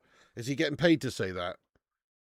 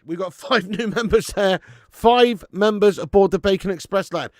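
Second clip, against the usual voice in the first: loud, high, chanting thanks for gifted members; hyped shout-out; 11 semitones higher, 10 dB louder, slower than usual. Acted. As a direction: pump up the chat like a hype man.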